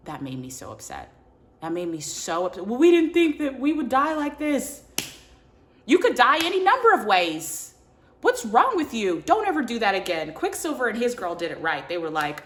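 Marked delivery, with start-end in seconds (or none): 2.7-4.8 high, loud mimicry of a lame excuse; mocking imitation. Mimics the couple's excuse for pact. 5.9-10.3 loud, high-pitched mock telling-off; exasperated scolding. Scolds the characters over death pact.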